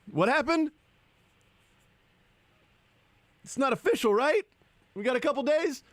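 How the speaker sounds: high-pitched acted objection; hammed-up high-pitched innocence; mock innocent protest. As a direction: play someone wriggling out of a deal, voice way up. Acts player backing out of deal.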